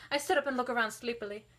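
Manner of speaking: ordinary delivery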